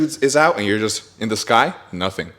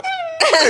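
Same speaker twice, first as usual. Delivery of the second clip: very high startled squeal; re-enacted yelp. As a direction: squeal at a sudden jolt. Acts out yelp at each maneuver.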